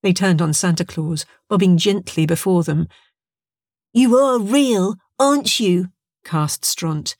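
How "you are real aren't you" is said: put-on high, drawn-out childlike question; child creature. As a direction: ask slowly in a small, raised voice. Child creature asks Santa.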